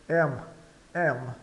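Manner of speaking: "Am" with a wide eh vowel, then m; open E. Shown as a demonstration. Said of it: "soa é aberto".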